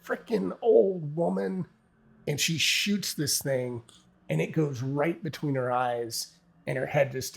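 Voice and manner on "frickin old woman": high, loud, fierce taunt; defiant battle cry. Acted. Suzanne taunting the old woman creature as she shoots, high fierce cry. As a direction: spit it at the monster as you fire. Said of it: "she's like".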